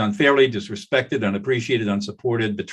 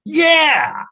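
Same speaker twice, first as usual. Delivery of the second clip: high, loud burst of agreement; the angry man finally bursts out with yeah; mock shouted yes; 8 dB louder than usual. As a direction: let the pent-up anger pop out in one word. Escalating yes, anger finally admitted.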